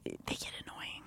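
breathy whispered aside. Real, not acted.

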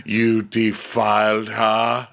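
slow, drawn-out mock royal accusation; accusing king. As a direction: intone it as an outraged king. King accuses his brother.

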